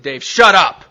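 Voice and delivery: sharp, loud order to shut up; retold shout. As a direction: cut him off with a loud command. Meeting leader silencing him.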